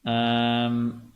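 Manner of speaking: usual speaking voice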